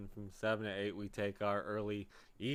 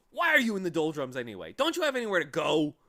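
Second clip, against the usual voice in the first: brusque questioning; 6 semitones higher, 10 dB louder, faster than usual. Acted. Watchdog interrogating Milo.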